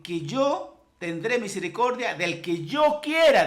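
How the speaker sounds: loud, emphatic exhortation; forceful preaching. Acted. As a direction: drive each command home.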